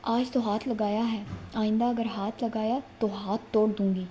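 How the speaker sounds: usual speaking voice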